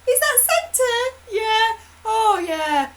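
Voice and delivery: put-on high sing-song praise to a child; mock delighted parent. Parents hiding dismay from their child, high fake delight. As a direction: gush over the drawing, voice way up. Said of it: "we go".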